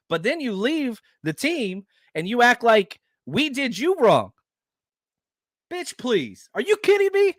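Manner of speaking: real frustration boiling over at a departed player; getting heated. Real, not acted. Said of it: "I'm getting hot over here".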